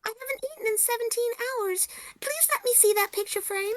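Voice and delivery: put-on high-pitched excitable girl voice; young girl character. Player voicing the young girl reading the clue, high. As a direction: play the girl, high and eager.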